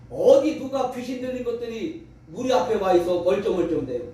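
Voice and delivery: ordinary talk, nothing marked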